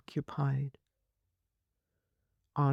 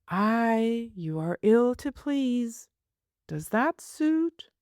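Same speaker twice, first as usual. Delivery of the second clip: a touch of a fond, scolding nurse, a little higher; lightly voiced nurse; 7 semitones higher than usual. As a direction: play the nurse, mildly exasperated.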